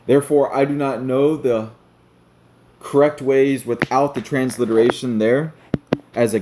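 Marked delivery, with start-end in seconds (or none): none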